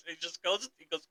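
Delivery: ordinary talk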